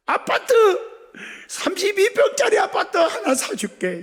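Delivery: high-pitched, emphatic vow; excited promise. Acted. Moved father promising his grateful daughter, high excited vow. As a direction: burst out with a generous promise.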